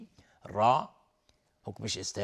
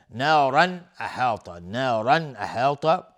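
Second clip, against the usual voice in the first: loud, slow chanted tilawa; loud Quran recitation; 7 dB louder, slower than usual. Shown as a demonstration. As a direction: project the verse slowly like a reciter. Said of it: "tajweed demo of the phrase". (تلاوت بلند و آهسته)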